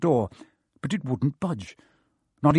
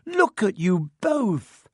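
exasperated; 7 semitones higher, slower than usual. Acted. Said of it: "shrieked Hermione".

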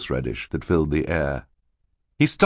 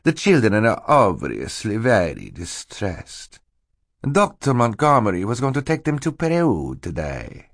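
false Stefano; 4 semitones higher than usual. Acted. Stefano excuses the children's distress, falsely concerned. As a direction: explain with oily sympathy.

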